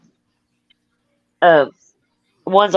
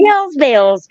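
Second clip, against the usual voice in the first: quoting herself; 7 semitones higher than usual. Retold or imitated. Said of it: "I'd be like".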